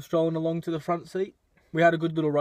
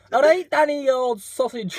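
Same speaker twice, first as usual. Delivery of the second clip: high mock English accent; English accent, lightly put on; 7 semitones higher than usual. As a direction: play a cheery Englishman.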